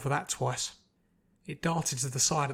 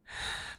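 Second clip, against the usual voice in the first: deep sigh; 8 dB quieter than usual. A narrator's reading. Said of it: "took a very deep breath".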